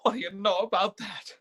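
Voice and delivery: usual speaking voice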